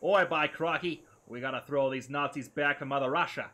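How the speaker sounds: botched Russian that turns into a loud bad Australian accent. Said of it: "another really bad Australian".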